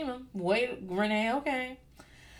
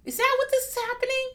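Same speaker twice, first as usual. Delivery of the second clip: shocked self-questioning, jumping way up in pitch and rushing; catching herself off guard mid-episode; sudden self-doubt; 12 semitones higher than usual. Retold or imitated. Recalling her sitcom crush, she asks herself in high, rushed disbelief. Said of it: "I was like".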